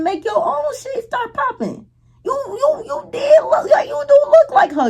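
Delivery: high-pitched emphatic insistence; exasperated insistence. Real, not acted. Urging the sister's missed chance.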